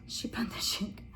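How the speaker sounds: very soft whispered try at the name; whispered to herself. Real, not acted. Vlogger rehearsing a tricky channel name, whispered. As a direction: test it under your breath.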